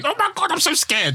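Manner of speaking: very high squeaky mock fear; mock scared passenger. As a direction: whimper it like a frightened commuter. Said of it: "you're there running off your seat and crying".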